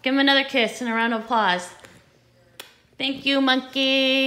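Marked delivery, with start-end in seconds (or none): 3.0-4.3 slow thank you. Acted. Applauding the toy monkey.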